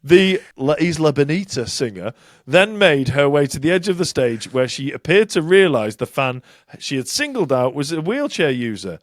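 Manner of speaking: ordinary delivery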